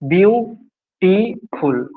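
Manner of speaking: Beautiful said very slowly, syllable by syllable; slow model pronunciation. Shown as a demonstration. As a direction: drag beautiful out slowly so students can count its syllables. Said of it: "beautiful উচ্চারণ করো".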